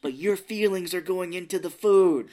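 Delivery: hammed-up explaining of the obvious; mock exasperation. Acting exasperated aunt explaining the obvious.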